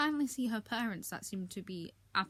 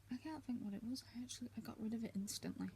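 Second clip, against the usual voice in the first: whispered aside; 2 semitones higher, 10 dB quieter, faster than usual. Real, not acted. Muttered aside while recalling book.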